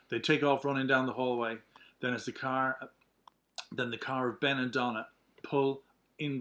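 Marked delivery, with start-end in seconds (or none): none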